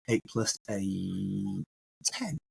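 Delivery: very slow and soft, drawn out; slowed-down, quiet emphasis demo. Shown as a demonstration. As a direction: say it slowly, stretching each word. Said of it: "What if I say it slower?".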